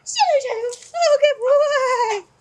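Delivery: loud, very high, drawn-out squeal; over-the-top high-pitched cheering; excited dog praise. Shown as a demonstration. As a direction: hype the dog up with just the voice. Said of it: "do it with just my voice".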